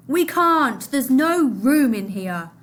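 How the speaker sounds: loud refusal shouted down; man yelling from the dinghy. Acted. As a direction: shout them away. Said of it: "a man inside the dinghy yelled".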